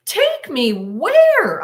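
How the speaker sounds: high, loud, slow alarmed question; frightened outcry. Retold or imitated. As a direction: demand it in rising panic. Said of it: "And I said out loud".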